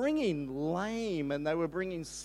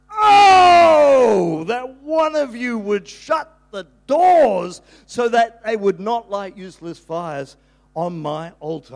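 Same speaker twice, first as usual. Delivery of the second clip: crying out; 14 dB louder, slower than usual. Acted. Preacher voicing God crying out over useless offerings, loud and pleading. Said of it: "God crying out said".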